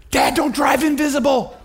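high, loud comic yell; hammed-up shriek of alarm; alarmed kid's shout. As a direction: yell a warning to dad, pitch way up. Son's alarmed warning, retold.

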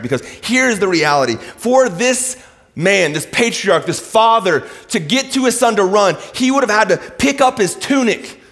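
high, loud, pressing sermon; preaching at full force. Acted. Pastor urging his congregation, preaching hard and high. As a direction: drive the point home to the congregation.

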